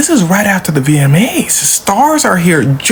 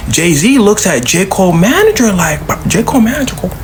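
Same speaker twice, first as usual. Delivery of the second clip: normal talking voice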